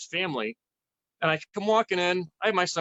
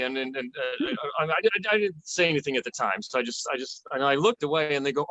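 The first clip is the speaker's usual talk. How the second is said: usual speaking voice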